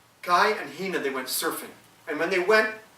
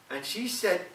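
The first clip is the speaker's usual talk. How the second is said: plain reading voice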